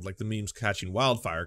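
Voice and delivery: ordinary talk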